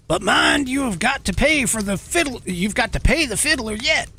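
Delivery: high-pitched mock admiral warning; hammed-up officer's voice, pitched well up; British admiral. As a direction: call out a warning as a taunting officer. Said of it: "a British admiral".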